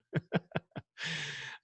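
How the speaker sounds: quiet chuckle at own joke; real soft laugh. Real, not acted. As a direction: laugh softly, amused by your own joke.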